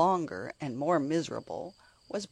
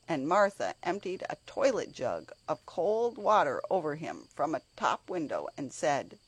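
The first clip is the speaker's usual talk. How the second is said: ordinary delivery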